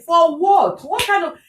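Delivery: indignant, high-pitched challenge; retold anger. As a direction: re-enact your exasperated protest. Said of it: "I was so angry at a point".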